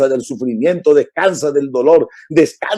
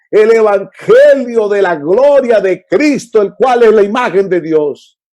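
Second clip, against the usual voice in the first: slow, loud, higher preaching; full-force preaching; 4 semitones higher, 3 dB louder than usual. Real, not acted. Preacher proclaims the gospel to the congregation, slow and forceful. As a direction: proclaim it to the whole church.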